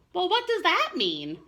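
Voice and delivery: put-on high, puzzled little kid voice; curious child. Chester asking his mother, high childlike confusion.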